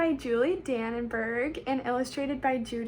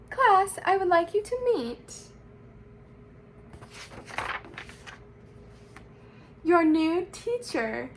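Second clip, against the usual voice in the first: raised, grand introduction; announcing to class; 5 semitones higher, 4 dB louder than usual. A narrator's reading. Principal introduces the new teacher, announcing. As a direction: build up to the big reveal.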